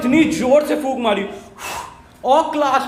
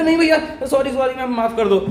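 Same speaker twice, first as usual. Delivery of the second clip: mock pleading; 3 semitones higher than usual. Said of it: "ये ऐसा कुछ था नहीं".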